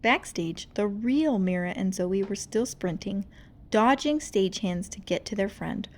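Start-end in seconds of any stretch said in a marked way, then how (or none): none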